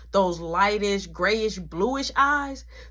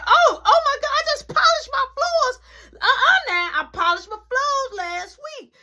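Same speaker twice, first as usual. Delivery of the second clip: high, loud fussing mom; mama fussing; 5 dB louder than usual. Retold or imitated. Mama upset about her polished floors, high and loud.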